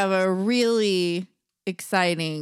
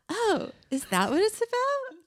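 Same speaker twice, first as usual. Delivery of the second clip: mimicking friend's fake surprise; 12 semitones higher than usual. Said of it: "You're all".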